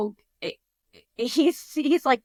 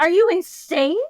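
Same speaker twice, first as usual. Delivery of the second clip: loud, higher retold exclamation of disbelief; exasperated outburst; 8 semitones higher, 8 dB louder than usual. Husband balking at lifting the heavy meat cooler, imitated exasperation. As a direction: burst out as an incredulous husband.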